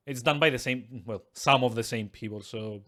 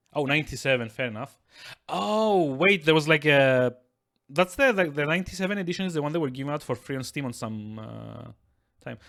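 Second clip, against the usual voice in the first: usual speaking voice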